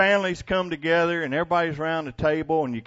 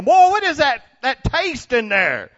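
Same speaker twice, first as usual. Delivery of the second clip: cowboy tasting pie; 10 semitones higher, 5 dB louder than usual. Retold or imitated. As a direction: play a cowboy who just tasted something strange. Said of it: "They go".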